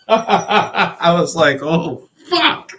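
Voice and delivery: laughing, stretched out. Real, not acted. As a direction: laugh through the words. Laughing at the memory.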